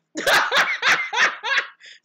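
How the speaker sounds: loud, high cackle; big ringing belly laugh. Real, not acted. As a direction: burst into a high staccato laugh. Imitating or sharing the laughing moment.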